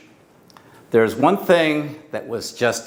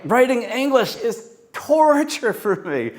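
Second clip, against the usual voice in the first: "torture" stretched and pained, pitched up; emotional take of the line; anguished; 8 semitones higher than usual. Shown as a demonstration. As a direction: make us feel the torture of it. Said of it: "Just bring it alive with the emotion".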